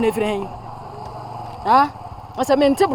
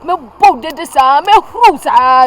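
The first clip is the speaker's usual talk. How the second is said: slow, loud threat; threatening; 3 semitones higher, 8 dB louder, much slower than usual. Acted. Mother threatening to beat her daughter, slow and loud. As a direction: spell out the threat word by word.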